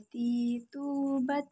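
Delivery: ordinary talk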